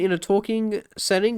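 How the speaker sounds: ordinary talk, nothing marked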